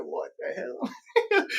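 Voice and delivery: slow, high, stunned; mock awe. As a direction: drag it out like you are gaping at a gold ceiling. Gaping at the ceiling.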